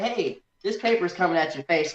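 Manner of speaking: usual speaking voice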